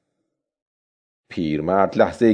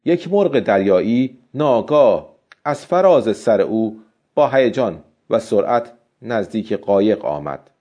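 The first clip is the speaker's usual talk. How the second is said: ordinary delivery, nothing marked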